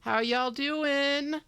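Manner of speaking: bright, loud, high-pitched hello to the viewers; hyped stream greeting. Real, not acted. Host greeting the stream crowd, big and excited. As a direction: pump up the audience at the start.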